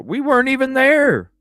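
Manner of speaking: loud, high disbelief; indignant exclamation; incredulous outburst. Real, not acted. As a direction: blurt it out, stunned he'd lie. Retelling a false accusation.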